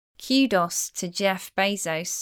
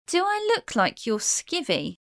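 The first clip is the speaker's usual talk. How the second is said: higher, indignant rhetorical question; 8 semitones higher than usual. Shown as a demonstration. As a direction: sound offended at the idea.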